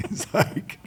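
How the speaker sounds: breathy high laugh; amused laugh. Real, not acted. Himself, amused by Corey's reaction, breathy laugh. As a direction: laugh at the memory.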